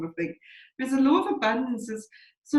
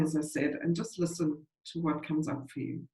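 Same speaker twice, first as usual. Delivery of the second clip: ordinary talk, nothing marked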